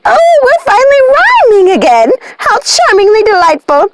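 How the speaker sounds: high, squealing witch voice, bursting with delight; shrill-free high sing-song glee; gleeful witch sister. Acted. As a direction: squeal it like a giddy witch clapping. Ruby's eyes widened with glee.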